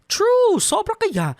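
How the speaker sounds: high, loud squeal of agreement; loud high gushing "True!"; excited agreement. Acted. Friend eagerly agrees.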